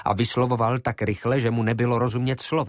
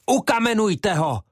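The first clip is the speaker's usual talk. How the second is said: much higher, louder full-throat roar; a mob leader bellowing for blood; 11 semitones higher, 4 dB louder than usual. Acted. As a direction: scream it with all your breath. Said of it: "Zařval tak".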